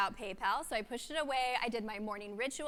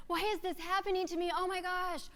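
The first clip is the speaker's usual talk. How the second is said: mock whiny panic; 7 semitones higher than usual. Voicing a panicked self-pity question.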